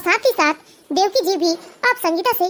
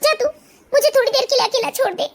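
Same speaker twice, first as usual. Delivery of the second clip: mock-weeping mother, pitch lifting at the end; acted tearful plea to be left alone; 4 semitones higher, slower than usual. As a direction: brush off your son through tears. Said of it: "उसकी माँ रोते हुए".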